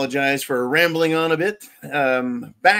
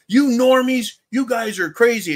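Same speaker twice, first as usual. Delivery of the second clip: mocking leftist jeer; 6 semitones higher, 4 dB louder than usual. Mocking how leftists call him.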